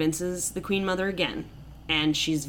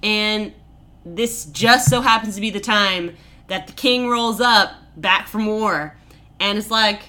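usual speaking voice

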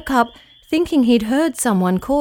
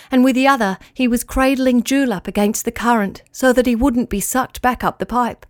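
ordinary delivery

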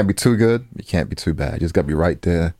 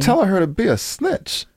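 higher, scornful jab; indignant jab; 12 semitones higher than usual. Real, not acted. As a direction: throw it back at her scornfully. Heated argument with co-host.

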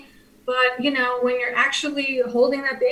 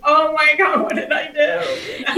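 laughing; 3 semitones higher, 4 dB louder, slower than usual. Retold or imitated. Laughing at new-mom panic.

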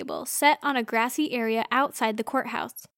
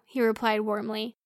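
ordinary delivery

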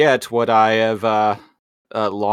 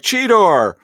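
worried shout; 11 semitones higher, 5 dB louder than usual. Retold or imitated. Optimus shouts in alarm.

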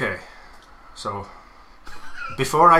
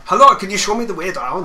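put-on higher, louder European tourist accent; fake European accent; 5 semitones higher, 8 dB louder than usual. Lost backpacker asking locals the way, fake European accent. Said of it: "with fake European accents".

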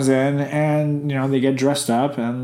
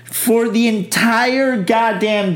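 higher, incredulous what the fuck; exasperated disbelief; 9 semitones higher, slower than usual. Retold or imitated. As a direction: scoff at him in disbelief. Outraged retelling to boyfriend.

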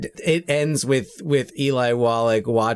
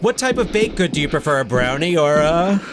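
fast, high-pitched joke question; playful quizzing; 4 semitones higher, faster than usual. Pretend. Co-host cueing the film's shout as the punchline, playful quizzing. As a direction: rattle off the choices eagerly, left hanging. Joking setups for clip's shouted "Blondie.

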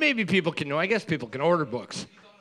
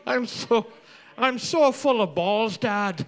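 put-on Braveheart Scottish accent; 5 semitones higher, slower than usual. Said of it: "you are doing the Braveheart accent".

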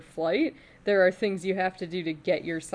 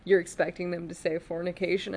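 normal talking voice

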